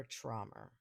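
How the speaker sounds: "trauma" with a New York accent, dark "traw-muh". Real, not acted. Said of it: "My New York accent got a little crazy there".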